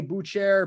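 "Boucher" said as BOOT-share, with a ch sound; alternative pronunciation. Shown as a demonstration. Host running through the options, "Boucher" as BOOT-share.

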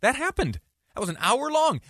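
high, surprised gasp of memory; sudden realization. Retold or imitated. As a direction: voice the listener remembering with a jolt. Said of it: "You might be thinking to yourself".

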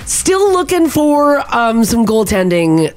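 upbeat radio sports update; sports news read. Acted. Reads hockey news.

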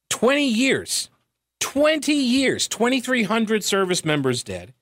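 high, incredulous lament; exasperated disbelief. Real, not acted. Exasperated at the cost.

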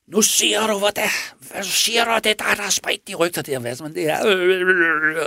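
a raging man lost for words; menacing demand. Retold or imitated. Man threatens, iron raised high.

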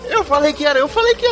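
high, rising panic; excited. Acted. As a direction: shriek I told you so. Panicked told-you-so.

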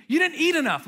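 high, loud motherly scolding; insistent mother. Retold or imitated. Mom pressing food on her grown child, insistent. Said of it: "my mom does it to me".

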